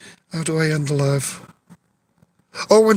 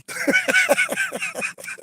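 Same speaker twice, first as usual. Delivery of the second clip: high laugh; 5 dB quieter than usual. Real, not acted. Laughing at cop joke.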